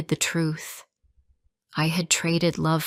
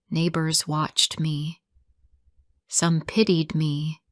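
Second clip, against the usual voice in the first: plain reading voice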